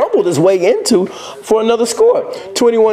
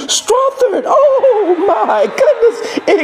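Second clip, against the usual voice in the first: high, laughing shout, drawn out; excited; 13 semitones higher, much slower than usual. Real, not acted. Announcer marvelling at the catch, laughing shout. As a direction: lose it over an incredible catch.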